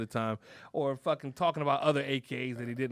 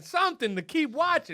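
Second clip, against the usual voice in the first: loud, higher-pitched mock plea; exasperated demand; 10 semitones higher, 4 dB louder than usual. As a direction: plead loudly with an absent president. Acted plea addressed to Biden.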